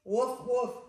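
loud playful woof; puppy barking. Acted. Librarian voicing Biscuit the puppy for kids, loud woofs. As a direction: bark like a little puppy. Dog barks in the story.

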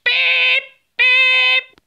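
high, loud robotic beeping; alarm beeps. Pretend. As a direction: beep like an alarm going off. Alarm goes off, intruder found.

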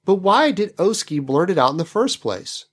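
ordinary talk

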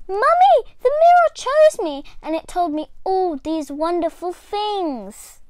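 high, loud, bursting excitement; can't wait to tell; overjoyed little girl. A narrator's reading. Little Diane rushing to tell mum the news.

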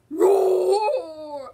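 long, loud mock tiger roar. Player voicing Tiger in a game, long loud roar. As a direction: draw the roar out loudly.

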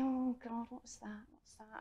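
soft, hushed alarm; a startled swimmer fearing a shark; hushed panicked self-talk; frightened thought. Retold or imitated. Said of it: "and I'm thinking".

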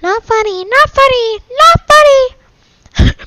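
very high, loud squeal, rising with each repeat; squealed complaint. Real, not acted. Friend annoys streamer during game.

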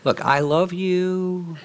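slow, gentle reassurance; slow down and mean every word; tender reassurance. Shown as a demonstration. Parent calmly reassuring a child during a conflict. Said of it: "we had this conversation every day".

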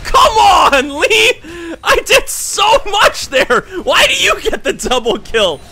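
high, loud wail of protest, half laughing; wailing, laughing exasperation; anguished shouting. Real, not acted. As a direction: cry out in disbelief at a teammate. Loses double kill to teammate.

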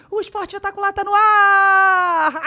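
high, loud, slow trembling cry; frantic shout. Retold or imitated. Host opening the live show from the jump tower, crying out. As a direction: shout it out terrified but on air.

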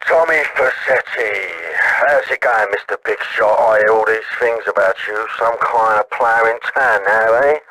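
put-on slow, low drunken drawl. Drunk caller needling Tommy, the big shot in town, slurred and slow. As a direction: sneer it slowly with drink in you. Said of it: "[name], you're drunk".

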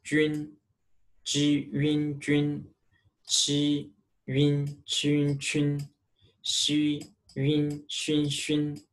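jun, qun, xun built from ji, qi, xi; pinyin drill. Shown as a demonstration. Teacher drills jun qun xun for students. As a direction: spell out j-yun, q-yun, x-yun. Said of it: "It pronounced yun".

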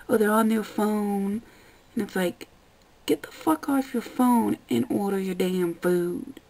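ordinary delivery

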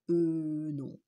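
hesitant euh with a stammer; flustered wolf caught out; nervous mumbled denial. A narrator's reading. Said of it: "bredouilla Lou".

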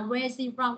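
"where is he from" linked as "where-zee from"; linked, silent H. Shown as a demonstration. Teacher explaining linking to students, "he" said without its H in "where is he from". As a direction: drop the H of "he" and join it to a z sound. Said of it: "nó sẽ không đọc chữ H".

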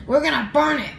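ordinary delivery

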